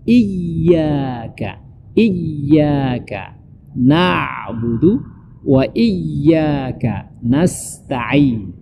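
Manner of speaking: slow chanted "Iyyaka", leaning long on the y; drawn-out recital stressing "iyyaka"; pressed double y. Shown as a demonstration. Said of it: "Jadi, mesti ditekan".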